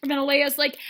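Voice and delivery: usual speaking voice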